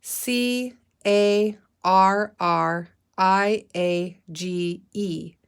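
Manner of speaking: slow letter by letter spelling; spelling aloud. Shown as a demonstration. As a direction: spell each letter out slowly. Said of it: "Let's spell carriage".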